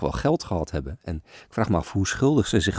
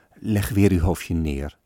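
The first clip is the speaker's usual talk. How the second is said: usual speaking voice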